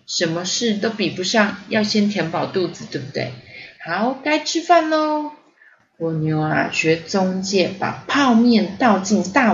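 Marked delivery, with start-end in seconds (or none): 3.8-5.3 high, slow sing-out call; calling to dinner. A narrator's reading. Storyteller calling the children to eat, cheery call. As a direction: announce the meal brightly, stretched out.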